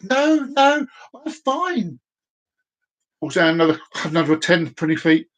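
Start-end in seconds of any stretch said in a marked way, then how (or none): 0.0-2.0 lightly voiced, high and carefree; a touch of a cheerful faller; breezy reply. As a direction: shrug it off while plummeting. Falling man answers instructor.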